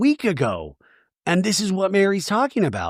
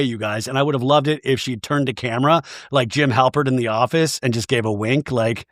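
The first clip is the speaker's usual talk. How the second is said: usual speaking voice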